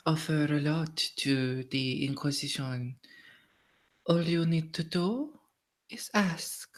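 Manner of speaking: gracious, refined society lady; measured offer with coy pauses; courtly lady Violette. Acted. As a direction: play a noblewoman offering her services.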